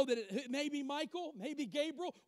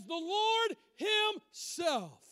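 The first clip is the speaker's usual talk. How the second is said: "Lord" and "Himself" punched, very slowly; emphatic; 3 semitones higher, 4 dB louder, much slower than usual. Shown as a demonstration. Preacher insisting it is no stand-in, emphatic. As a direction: break "Himself" into two heavy, drawn-out beats. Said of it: "It's emphatic".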